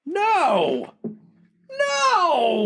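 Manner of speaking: hammed-up goddess throwing a fit; Hera's outburst. Hera watching the hero get the girdle, mock high shriek. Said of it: "she's like".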